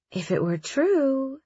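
put-on high sing-song little girl. A narrator's reading. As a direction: tease it like a little girl singing. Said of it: "sing-songed in a little girl's voice".

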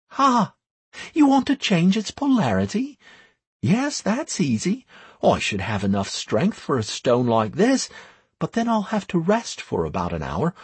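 high-voiced, self-assured priestess; confident priestess. A narrator's reading. Priestess asked to change a stone's polarity, high and assured. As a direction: answer high and sure, then question it.

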